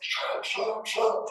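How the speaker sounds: high, hushed, urgent; hushed urgent shut up. Retold or imitated. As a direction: hiss it to silence them. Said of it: "And Ken's like".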